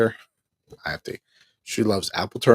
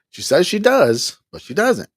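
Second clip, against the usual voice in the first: exasperated; 11 semitones higher, 9 dB louder than usual. Real, not acted. Exasperated punchline.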